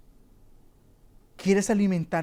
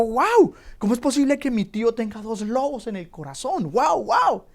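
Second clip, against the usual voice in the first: put-on high, wide-eyed child voice; amazed little boy; 6 semitones higher, 6 dB louder than usual. Boy marvelling at his uncle's two wolves, high and childlike. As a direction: play the boy gasping in wonder.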